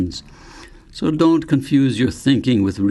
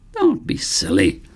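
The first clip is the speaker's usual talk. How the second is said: usual speaking voice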